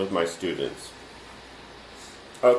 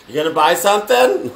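laughing tease; 8 semitones higher, 8 dB louder than usual. Pretend. Mock sales pitch to viewer.

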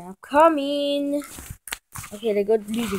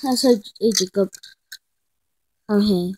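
normal talking voice

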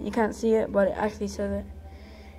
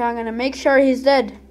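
normal talking voice

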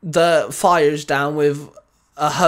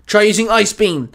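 higher, louder barked command; barked order; 6 semitones higher, 5 dB louder than usual. A narrator's reading. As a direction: snap out the attack. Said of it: "Baz barked".

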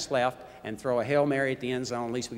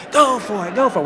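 mock fan shout, higher and louder; crowd yelling; 6 semitones higher, 6 dB louder than usual. Coach imitating fans yelling from the stands.

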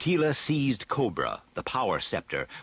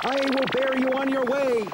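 higher, louder heroic warrior voice; Stratos crying out; 4 dB louder than usual. Acted. Stratos offering to carry Tila, calling out boldly. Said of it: "cried Stratos".

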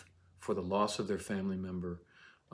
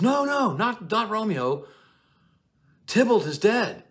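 shrieking high and loud, wailing "he's dead"; hysterical nurse; 13 semitones higher, 10 dB louder than usual. Acted. Nurse bursting in to Juliet, shrieking in a hammed-up panic. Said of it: "the nurse says".

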